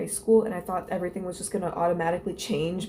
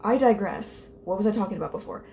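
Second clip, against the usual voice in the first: usual speaking voice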